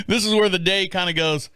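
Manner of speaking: ordinary talk, nothing marked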